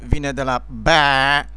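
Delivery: ordinary delivery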